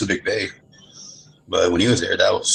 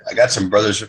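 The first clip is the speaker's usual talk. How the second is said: ordinary talk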